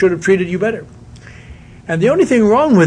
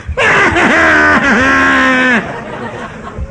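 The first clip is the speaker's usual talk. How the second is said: big laugh; 8 semitones higher than usual. Real, not acted. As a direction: crack up laughing. Laughs at scene.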